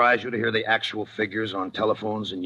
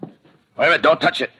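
raised, quick don't-touch-it; sharp warning; 9 semitones higher, 6 dB louder, faster than usual. Acted. Detective warning the witness off the doorknob, sharp raised voice. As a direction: stop her hand before she ruins the prints. Cop stops her touching evidence.

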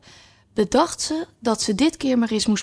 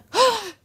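sharp high gasp; 13 semitones higher than usual. Acted. Larkspur hears the queen coming.

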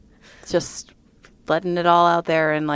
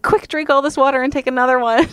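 hurried excitement; 11 semitones higher, 9 dB louder than usual. Retold or imitated. Rushing to retest.

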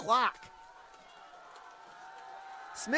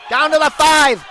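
excited call; 2 semitones higher, 8 dB louder than usual. Real, not acted. Big run on the sweep.

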